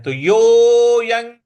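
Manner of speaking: यो को दीर्घ खींचकर; drawn-out यो. Shown as a demonstration. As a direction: pull the vowel of यो out long. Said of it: "इसको हमें दीर्घ खींचना है".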